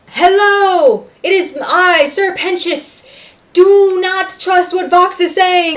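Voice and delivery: high, loud, slow theatrical announcing; grand villain entrance. Retold or imitated. Sir Pentious making a grand pompous entrance to warn everyone. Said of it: "and he comes down".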